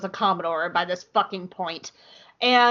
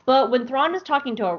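ordinary talk

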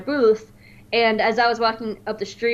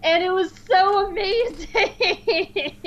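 laughing; 9 semitones higher, slower than usual. Real, not acted. Laughing while recalling the scene.

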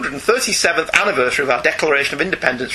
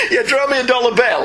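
giggly and higher; laughing; 9 semitones higher than usual. Real, not acted. Host laughing as he riffs on the dollar bill joke. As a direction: repeat the gag through laughter.

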